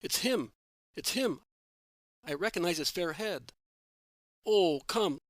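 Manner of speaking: excited shout. A narrator's reading. Simon spots Jesus and shouts to his companions, thrilled. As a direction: cry out as you spot him. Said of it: "shouts Simon".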